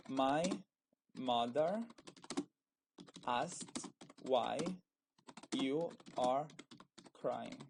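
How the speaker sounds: spaced out with long pauses between words; word-by-word dictation. Shown as a demonstration. Teacher writing out an example for students, word-by-word dictation. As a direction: dictate it slowly, one word at a time.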